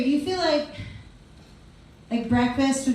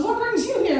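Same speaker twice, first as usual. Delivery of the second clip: high, chirpy hostess voice; mock polite guard; 9 semitones higher than usual. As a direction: greet her like a cheerful receptionist. Imitating the guard's question.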